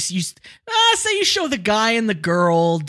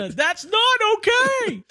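indignant yell; slower than usual. Acted. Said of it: "yell about this".

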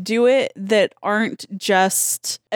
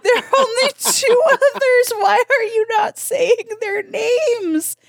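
exasperated quote; 12 semitones higher than usual. Retold or imitated. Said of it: "I was like".

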